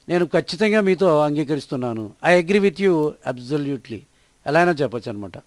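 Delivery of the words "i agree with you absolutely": slow, word by word, clearly spaced; slow model sentence. Shown as a demonstration. Teacher to Telugu learners, modelling the English sentence slowly. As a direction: read it slowly for learners to copy. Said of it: "teacher models the sentence slowly".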